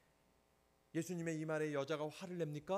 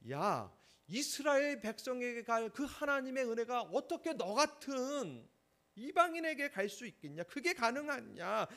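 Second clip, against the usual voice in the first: paraphrase Jesus as a scornful challenger, raised and loud; harsh scornful rebuke; 7 semitones higher, 6 dB louder than usual. Retold or imitated. Preacher voicing Jesus scorning the foreign woman, high loud scorn. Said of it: "다시 말하면".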